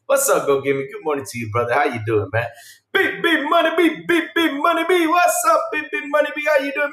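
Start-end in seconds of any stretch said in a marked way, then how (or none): 2.9-6.9 high, chanted beep beep money; beep beep greeting. Pretend. Streamer greets a viewer with his catchphrase. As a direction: bounce through it like a cartoon horn. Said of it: "the way I say good morning".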